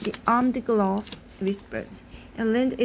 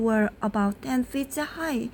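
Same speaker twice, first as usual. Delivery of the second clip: plain reading voice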